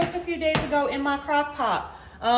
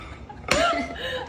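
laughing; 11 semitones higher than usual. Real, not acted. Laughs at the heavy plate.

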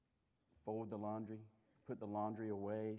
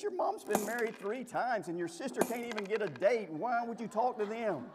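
husband hits back; 8 semitones higher, 6 dB louder than usual. Retold or imitated. Husband snapping back at his wife about her family, louder and higher. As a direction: fire back with a cutting comeback. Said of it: "And he goes".

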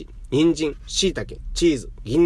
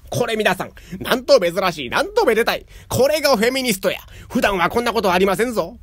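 high, louder showman's cry; delighted announcement; 7 semitones higher, 3 dB louder than usual. A narrator's reading. Young head proclaims to guests, gleeful and loud. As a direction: proclaim it to the crowd with glee.

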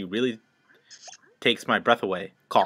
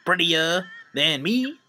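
put-on vain girl voice; 8 semitones higher than usual. Voicing vain character.

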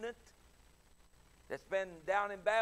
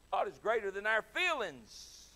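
raised preacher's proclamation; preaching; 4 semitones higher, 5 dB louder than usual. Acted. Preacher proclaiming to the church, full voice. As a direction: declare it over the congregation.

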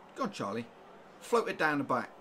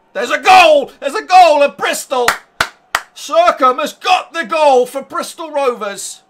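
screamed high and loud, goal call; ecstatic goal shout; 11 semitones higher, 22 dB louder than usual. Real, not acted. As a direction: yell the news like a commentator losing it. Announcing team's goal elsewhere.

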